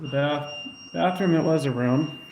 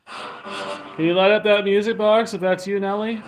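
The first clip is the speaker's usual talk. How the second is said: higher, projected request to a spirit; raised-voice appeal, pitched up; calling out; 6 semitones higher, 4 dB louder than usual. Real, not acted. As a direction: call out coaxingly to someone unseen.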